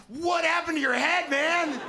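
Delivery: loud, very high-pitched mock shock; shocked outburst. Comic's first thought on seeing the man's scar, shocked. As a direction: blurt it out high and loud, aghast.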